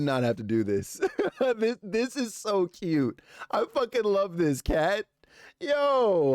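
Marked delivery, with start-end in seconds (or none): 1.1-1.5 high burst of happy laughter; giggling at a cute gift; delighted laugh. Real, not acted. Delighted by the fan art. 1.6-5.0 high, slow, gushing delight; thrilled streamer gushing over a cat drawing. Real, not acted. Gushing over fan art. 5.6-6.3 high, loud yo of delight; excited yo. Real, not acted. Reacting to fan art.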